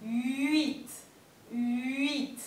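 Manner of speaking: "huit" with the sound sliding off the lips; French huit, lips forward. Shown as a demonstration. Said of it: "se resbala por los labios".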